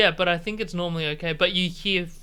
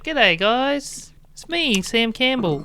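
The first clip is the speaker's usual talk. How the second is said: higher Australian comedian greeting his audience; light, raised-pitch comic impression; Sam Campbell; 7 semitones higher, 5 dB louder, slower than usual. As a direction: greet them in a put-on, higher Aussie voice. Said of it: "Sam's like".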